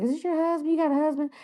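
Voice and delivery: high, prying teasing voice; nosy questions. Retold or imitated. Registration lady prying about a husband, high teasing. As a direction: poke at her like a busybody.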